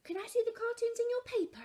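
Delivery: put-on high, quick eager child; child's voice. A narrator's reading. Laura asking Mum in the bath, high quick child. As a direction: rattle it off like an excited kid.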